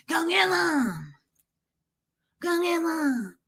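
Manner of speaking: high, slow, strangled mock howl; scary challenge. Woman wishing she had challenged him, mock scary howl. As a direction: stretch it into a hammed-up threat. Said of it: "it's scarier when you do it".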